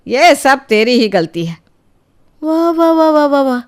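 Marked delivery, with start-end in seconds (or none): 2.4-3.6 sarcastic wah wah. Acted. Mother mocking grandmother's defence, sarcastic. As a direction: sneer out the praise.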